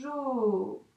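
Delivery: trace jo in the air with your voice; stretched syllable. Shown as a demonstration. Teacher writing jo in the air, drawn out. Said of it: "On pense bien à faire l'accroche".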